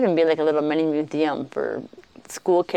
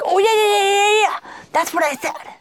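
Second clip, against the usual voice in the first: excited imitation. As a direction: gleefully imitate the host confirming his translation. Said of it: "he'd get all excited".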